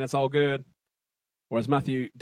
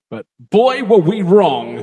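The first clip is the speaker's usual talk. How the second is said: loud punchline; 6 semitones higher, 13 dB louder, slower than usual. Acted. Punchline belted.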